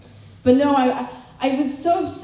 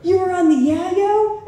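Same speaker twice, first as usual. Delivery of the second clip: shocked disbelief; 8 semitones higher, slower than usual. Retold or imitated. Shocked at mom's cocaine.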